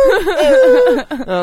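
high, slow words through laughter; laughing hard. Real, not acted. Laughing while telling cohost the zipper story.

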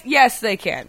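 ordinary talk, nothing marked

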